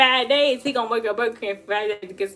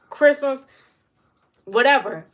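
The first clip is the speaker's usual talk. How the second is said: ordinary talk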